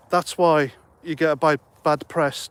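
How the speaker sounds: real tongue-tripped false start before "bad press"; stuttering. Real, not acted. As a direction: stumble on "bi-", then restart on "bad press". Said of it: "I'm still stuttering".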